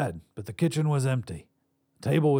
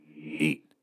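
low raspy one-word order; 8 semitones lower than usual. A narrator's reading. Visitor pushes the bacon over, low and raspy. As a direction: drop it low and rasp it. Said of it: "though a bit raspy".

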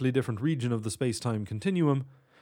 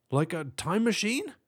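high-pitched cop question; high voice of a cop catching on; cop character voice; 9 semitones higher than usual. A narrator's reading. Cop asks in acted dialogue.

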